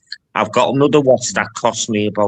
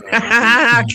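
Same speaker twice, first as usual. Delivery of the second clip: laughing; 12 semitones higher, 5 dB louder than usual. Real, not acted. Teasing Porky, laughs.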